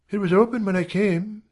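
high-pitched female character; high voice, simple retort; woman answering back. A narrator's reading. Janet answers Rawdon back.

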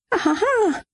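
sehr hoher, schriller Lachausbruch; hysterisches Lachen. Acted. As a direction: im Liegen hilflos auflachen. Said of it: "Ich muss nur immer lachen". (sehr hohes, hysterisches Auflachen)

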